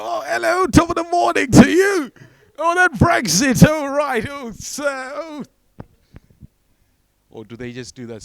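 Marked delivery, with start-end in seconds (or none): 0.0-5.4 very high, loud put-on greeting; hammed-up effusive hello, shouted way up high.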